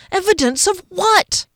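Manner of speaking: startled high cry; shocked question. A narrator's reading. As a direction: caught off guard, demanding.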